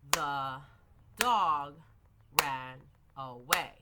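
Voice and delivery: "The... dog... ran... away", each word set apart for a clap; word-by-word demonstration. Shown as a demonstration. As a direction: separate "The", "dog", "ran", "away" with clear pauses. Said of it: "they can clap".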